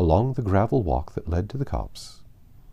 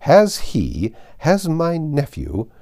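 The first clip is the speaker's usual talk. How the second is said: slow, louder, higher imperious fury; indignant insistence; 6 semitones higher, 5 dB louder, slower than usual. Acted. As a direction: spell out each word of the demand. Lady Catherine insists furiously.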